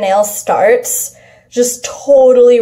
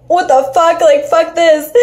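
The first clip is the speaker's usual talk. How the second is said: panicked disbelief; 7 semitones higher than usual. Retold or imitated. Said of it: "and I'm like".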